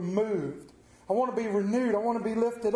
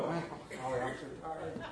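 tired groan; 6 semitones lower, 10 dB quieter than usual. Pretend. Acted tired churchgoer reply.

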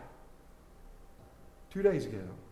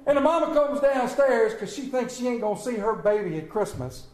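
ordinary delivery

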